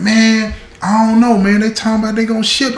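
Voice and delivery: high called-out yell from a cell; yelling from the hole. Retold or imitated.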